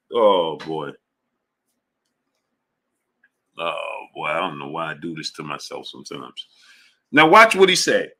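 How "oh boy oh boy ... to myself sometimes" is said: low, much softer, worn out; under the breath, giving up; defeated mutter. Real, not acted. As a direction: sigh it out to yourself. Defeated sigh at chatter.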